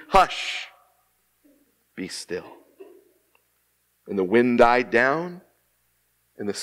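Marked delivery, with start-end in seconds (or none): 0.1-0.7 rebuke. Acted. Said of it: "rebuked the wind and said to the sea". 1.9-2.6 very soft, low command to the sea; calm the storm with a quiet, low word; hushed command. Acted. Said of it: "rebuked the wind and said to the sea".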